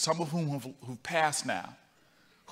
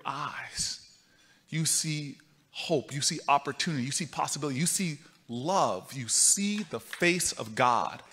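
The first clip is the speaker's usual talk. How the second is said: tender wisdom; 4 semitones lower, 6 dB quieter than usual. Retold or imitated. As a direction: hush it down like a sacred truth. Miss Jones's wisdom, softening.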